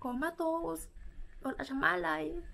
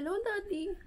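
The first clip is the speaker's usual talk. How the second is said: brother's high call; 10 semitones higher than usual. Retold or imitated. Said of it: "yung kuya ko, sabi niya".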